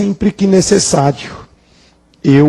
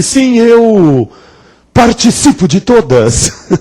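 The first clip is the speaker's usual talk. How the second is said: higher, hesitant then dodging the verb; imitated competitor; 8 semitones higher, slower than usual. Competitor answering a reporter, mock stalling on the verb. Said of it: "E ele".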